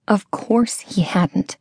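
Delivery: plain reading voice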